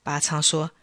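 usual speaking voice

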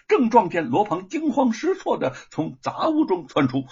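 ordinary delivery, nothing marked

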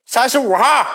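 high, loud call across the cafe; calling the attendant. Retold or imitated. Boy shouting for the attendant downstairs, loud and high. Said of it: "我一喊网管".

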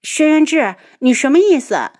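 wife protests her husband's teasing, loud and indignant. A narrator's reading. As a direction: snap back at the teasing husband.

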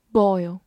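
"Boil" said clearly, twice; careful model reading of "boil"; model pronunciation. Shown as a demonstration. Teacher modelling vocabulary for learners. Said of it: "vocabulary model reading".

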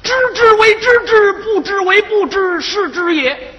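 high, sing-song classical recitation that falters at the end; quoting the ancients. Acted. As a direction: recite pompously, then stumble over the words. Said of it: "古人云".

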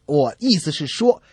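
normal talking voice

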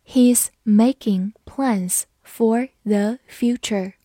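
slow, word-by-word reading; unhurried teacher dictation pace with small gaps; slow model reading. Shown as a demonstration. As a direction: separate every word clearly for learners. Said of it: "好，慢慢来读".

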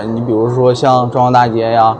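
ordinary talk

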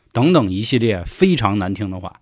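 ordinary delivery, nothing marked

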